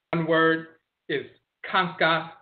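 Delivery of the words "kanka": "kanka" with open ah, KAHN-kah; dialect pronunciation. Shown as a demonstration. Lecturer giving the first word, "kanka" as KAHN-kah. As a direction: sound an ah and n in "kanka". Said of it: "also pronounced in the other dialect".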